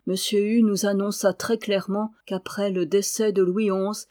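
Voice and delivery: ordinary delivery, nothing marked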